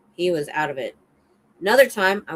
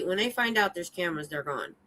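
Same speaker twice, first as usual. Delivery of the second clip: usual speaking voice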